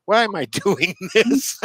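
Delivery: high, laughing question; laughing disbelief. Retold or imitated. The musher wondering why she races, laughing. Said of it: "I'm going like".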